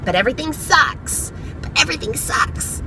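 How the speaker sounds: small, soft nagging voice in the head; little anxiety voice. Acted. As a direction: nag softly, like a creeping worry. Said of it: "she's a little voice in your head".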